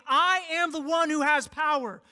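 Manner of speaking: high, loud call as the voice of God; preacher voicing God calling out loud; God crying out. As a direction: cry it out with divine power. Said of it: "God is crying out and saying".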